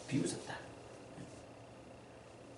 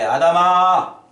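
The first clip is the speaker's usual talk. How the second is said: God addressing Adam; 3 semitones higher, 9 dB louder than usual. Acted. Voicing God speaking to Adam.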